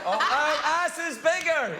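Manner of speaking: laughing, high Scottish accent imitation; imitating a Scottish accent. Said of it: "I don't understand the accent anymore".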